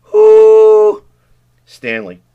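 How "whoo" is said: high, loud whoop ringing in a pipe; echoing whoop. Acted. Ellen testing the drain pipe echo, loud echoing whoop. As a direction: let a joyful whoop bounce off the walls.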